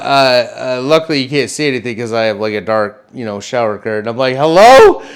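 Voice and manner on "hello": loud, high rising call. Retold or imitated. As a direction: call out to unseen intruders, alarmed. Calling out to intruders from shower.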